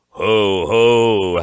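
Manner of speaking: loud, high monster howl. A narrator's reading. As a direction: howl it out as jeering creatures. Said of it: "howled the things again".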